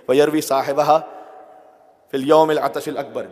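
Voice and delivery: loud, fast Arabic recitation; fervent recitation. Real, not acted. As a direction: drive the verse out with force. (پرجوش عربی تلاوت)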